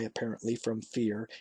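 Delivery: ordinary delivery, nothing marked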